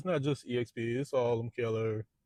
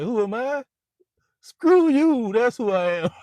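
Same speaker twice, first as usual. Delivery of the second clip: much higher, loud yell; furious shout; 12 semitones higher, 11 dB louder, slower than usual. Retold or imitated. Boss shouting down the salesmen who mock him, much higher and louder. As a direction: explode at them. Baldwin answering "who is this joker.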